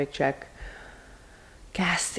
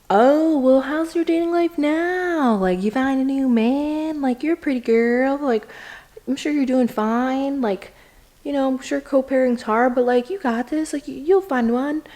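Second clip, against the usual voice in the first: higher, louder cheerful pep; upbeat encouragement; 6 semitones higher, 3 dB louder than usual. Retold or imitated. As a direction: hype her up like a friend. Encouraging the divorced girl.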